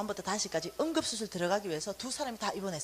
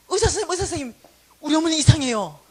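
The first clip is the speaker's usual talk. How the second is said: higher, much louder call for help; urgent call; 4 semitones higher, 10 dB louder, faster than usual. Retold or imitated. Daughter calling the doctor to her mother, loud.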